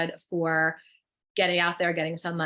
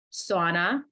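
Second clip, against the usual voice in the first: unplanned touch of New Jersey accent. Real, not acted. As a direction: let the Jersey accent slip out. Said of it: "That was my Jersey accent coming out".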